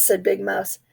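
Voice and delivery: ordinary delivery, nothing marked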